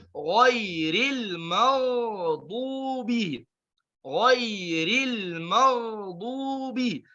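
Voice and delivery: slow chanted ghairil maghdubi with a heavy gh; recitation with thick ghain. Shown as a demonstration. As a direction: tajwid recitation. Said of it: "tolong ditebalkan".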